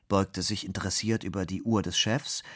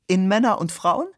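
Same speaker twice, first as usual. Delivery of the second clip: high, louder silly-colleague voice; 10 semitones higher, 7 dB louder than usual. A narrator's reading. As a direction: pipe up as the silly office mate. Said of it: "der alberne Kollege". (hohe Stimme des albernen Kollegen)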